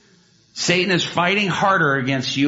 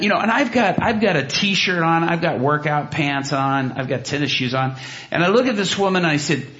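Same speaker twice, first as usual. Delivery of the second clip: ordinary talk, nothing marked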